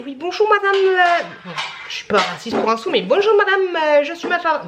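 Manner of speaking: high-pitched mock call-centre greeting; imitating telemarketer. Imitating a caller who greets her on the phone, mock telemarketer. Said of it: "je comprends rien ce qu'il raconte".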